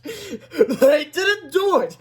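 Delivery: high, loud, very drawn-out laughed line; laughing. A narrator's reading. Amused woman to the principal, laughing. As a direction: laugh the words out slowly. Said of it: "laughed Miss Ripple".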